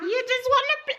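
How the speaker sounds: put-on high sing-song to the baby; baby talk. Mother cooing to her playful baby, high. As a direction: coo it at the child.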